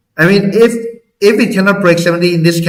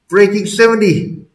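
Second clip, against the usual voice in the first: usual speaking voice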